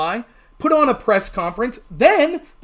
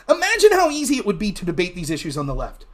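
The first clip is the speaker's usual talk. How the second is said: usual speaking voice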